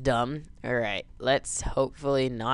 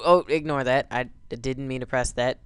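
ordinary talk, nothing marked

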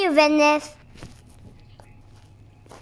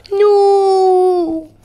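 gasp, then a high, loud no; long, loud pretend cry of dismay; mock horrified shout; 4 semitones higher, 6 dB louder than usual. Child acting out a planet mishap, mock horrified shout.

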